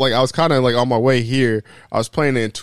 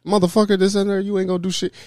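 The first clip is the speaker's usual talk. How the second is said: mock cussing out; 9 semitones higher, faster than usual. Cussing out an older dude to fight, mock angry. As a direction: rattle off a pretend challenge, pitched up. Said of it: "the only way I'm cussing out".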